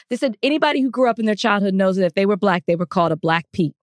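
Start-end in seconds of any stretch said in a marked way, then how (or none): none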